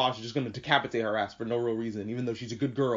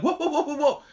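high rapid-fire whoas; alarmed protest; 12 semitones higher than usual. Retold or imitated. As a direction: halt them in mock alarm. Acting out protest at episode ending.

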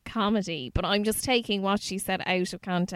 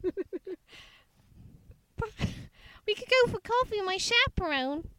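a higher, prim put-on voice with a laugh; Kylie impression; 11 semitones higher than usual. Friend imitates prim Kylie wanting a chaperone, higher mock voice. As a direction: play a chaste young Kylie, lightly mocked. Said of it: "And Kylie would have said".

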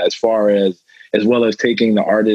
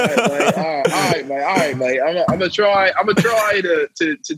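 higher-pitched try at an Aussie accent; attempted Australian accent; 6 semitones higher than usual. Shown as a demonstration. American guest obliging the hosts' request, attempted Aussie accent. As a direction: have a tentative go at sounding Australian. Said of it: "give us your best Aussie accent".

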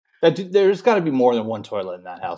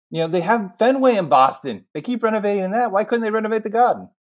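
a lightly slipped-in Boston accent; half-hearted Boston accent; 7 semitones higher, faster than usual. Real, not acted. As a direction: drift into a bad Boston accent. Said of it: "Why am I talking in a Boston accent terribly".

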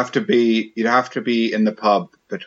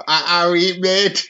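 slow, higher-pitched Scouse; Liverpool accent; 6 semitones higher than usual. Acted. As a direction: put on a drawn-out Liverpool accent. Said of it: "Was that your Liverpool accent?".

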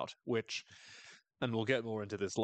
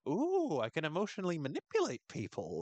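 much higher, delighted mock villain realization; gleeful scheming Misty; 6 semitones higher than usual. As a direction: squeal it like a kid discovering a trick.

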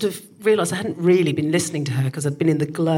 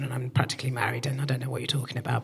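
fast whisper; 6 semitones lower, 9 dB quieter, faster than usual. Retold or imitated. What she should have said.

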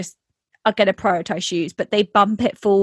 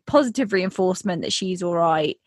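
usual speaking voice

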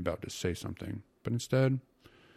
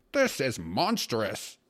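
loud, slow, furious outburst; high-pitched angry roar; angry exclamation; 5 semitones higher, 5 dB louder, much slower than usual. A narrator's reading. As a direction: explode in anger, drawing out each word. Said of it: "he exclaimed angrily".

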